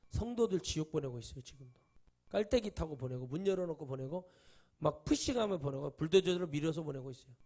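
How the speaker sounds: loud, hammering sermon at full force; fiery preaching. Acted. Pastor condemning ignorant pastors to his congregation, loud fiery preaching. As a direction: thunder it at the congregation.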